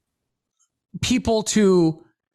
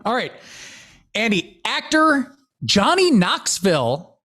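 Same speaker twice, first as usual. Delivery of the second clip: ordinary talk, nothing marked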